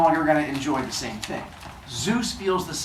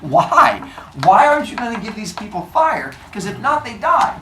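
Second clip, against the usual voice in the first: pleading protest; 6 semitones higher, 6 dB louder than usual. Acted. Prometheus argues with Zeus.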